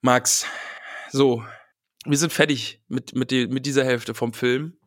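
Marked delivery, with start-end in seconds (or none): none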